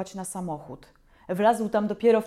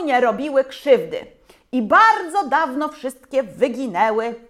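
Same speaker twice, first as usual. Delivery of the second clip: science teacher; 3 semitones higher, 6 dB louder than usual. A narrator's reading. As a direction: explain it to the crowd like a teacher. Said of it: "powiedział tak".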